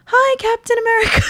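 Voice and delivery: put-on high, loud kid greeting; excited high little-boy voice; imitated small boy. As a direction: call out like a four-year-old to a hero. Small boy waving at hero.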